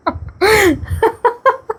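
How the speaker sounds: a very high, breathy squeal of laughter; high giggle. Real, not acted. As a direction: let the giggle run away. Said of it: "बहुत हंसी आ गई मेरे को".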